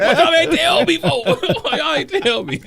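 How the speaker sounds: high laughing voice. Real, not acted. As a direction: laugh through the words. Cracking up after the quote.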